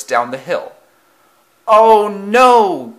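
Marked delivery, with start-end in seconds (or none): 1.7-2.9 high, loud wail of dismay; alarmed cry. A narrator's reading. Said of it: "cried eight seven five four six".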